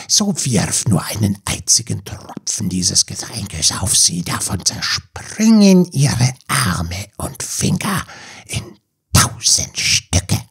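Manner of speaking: whispered, hissing sea witch prophecy; an old sea witch casting a spell in a whisper. Acted. Witch gives advice.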